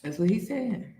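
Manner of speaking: ordinary talk, nothing marked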